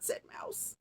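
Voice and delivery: usual speaking voice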